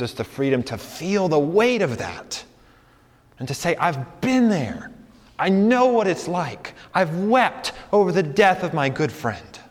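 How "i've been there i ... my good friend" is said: higher-pitched, drawn-out, earnest testimony; impassioned empathy. Acted. Preacher voicing one who has wept over a friend, impassioned. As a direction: testify with feeling, stretching the key words.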